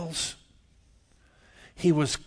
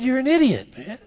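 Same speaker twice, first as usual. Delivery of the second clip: higher and louder blurt; loud insult; 9 semitones higher, 8 dB louder than usual. Retold or imitated. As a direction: fling the word idiot at him. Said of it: "And I said".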